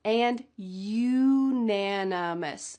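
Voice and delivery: anonymous and unanimous drawn out slowly; teacher slow pronunciation demo; slow careful contrast. Shown as a demonstration. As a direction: stretch anonymous then unanimous so each vowel shows. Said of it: "let's talk about the pronunciation".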